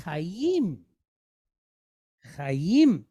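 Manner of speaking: "chayim" said alone, low and soft; word demonstration. Shown as a demonstration. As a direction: model "chayim" as two joined syllables, cha-yim. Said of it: "So the word is".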